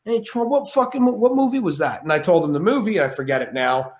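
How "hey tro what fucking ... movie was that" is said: high put-on De Niro; De Niro impression. De Niro brushing off Shane in the hallway, put-on voice. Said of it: "He goes".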